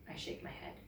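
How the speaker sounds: ordinary delivery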